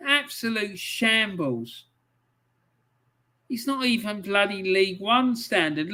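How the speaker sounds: ordinary talk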